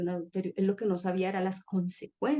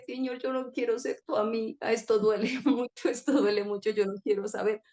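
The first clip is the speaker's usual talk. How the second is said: higher, tearful pleading with a break of laughter; mild imitated weeping plea; 3 semitones higher than usual. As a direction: retell begging the Lord through tears. Retold tearful plea to God.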